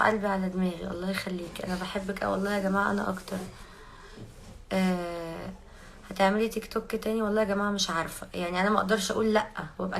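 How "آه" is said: normal talking voice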